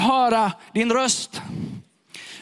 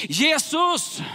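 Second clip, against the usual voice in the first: much higher, pleading cry; raised voice; 13 semitones higher than usual. Acted. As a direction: beggar raising his voice above the shushing crowd. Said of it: "han höjer rösten ännu mer".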